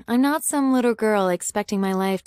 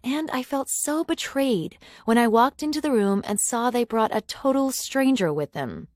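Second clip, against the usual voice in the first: plain reading voice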